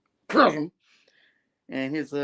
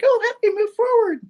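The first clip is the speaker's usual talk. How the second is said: very high, louder falsetto; mock Janine voice; 5 dB louder than usual. Janine pleading with the counselor, in loud mock falsetto. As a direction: squeal it as an imitation woman. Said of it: "And Janine's like".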